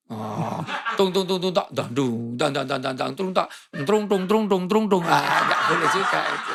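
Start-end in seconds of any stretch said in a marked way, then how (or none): 1.0-5.0 mouthed drum beats, rhythmic and bouncy; imitated music accompaniment. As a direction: sing out percussion syllables like a band. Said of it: "ngimam diiringi musik". (meniru bunyi iringan musik)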